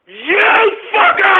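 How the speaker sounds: slow, high crying yell; outraged streamer screaming at a troll; furious shout. Acted. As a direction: explode at the chat. Reacting to a chat name.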